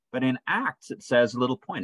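ordinary talk

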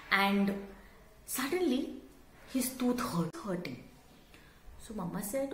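ordinary delivery, nothing marked

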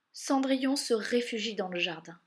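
ordinary delivery

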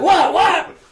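high, loud baffled what; startled what. Retold or imitated. Husband reacting to wife's outburst, startled high yelp. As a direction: yelp back in confusion. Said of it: "I was like".

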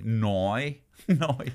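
drawn-out Northern Irish "noi" vowel; Northern Irish accent. Retold or imitated. Host imitating people from the north of Ireland, Northern Irish accent. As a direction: stretch the word with a Northern Irish twang. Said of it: "people from the north of Ireland".

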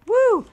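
high, loud "woo"; playful whoop. Real, not acted. Player speeding along as Lucio, playful whoop. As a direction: whoop with glee.

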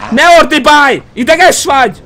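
mock scolding yell; loud retort. Yelling back at screaming enemy.

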